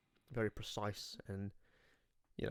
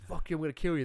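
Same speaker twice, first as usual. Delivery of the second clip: higher, louder imitation of a thug; mock angry threat; 7 semitones higher, 5 dB louder than usual. Friend retells gang threatening to kill a man, mock angry. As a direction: play-act a gang's threat.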